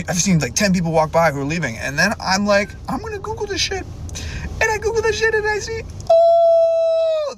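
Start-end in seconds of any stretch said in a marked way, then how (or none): 2.9-7.3 very high, squeaky retelling; high-pitched mock discovery; acted-out realization. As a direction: squeal it high, stretching the final oh. Acting out his discovery that it's closed.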